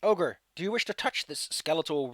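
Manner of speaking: ordinary talk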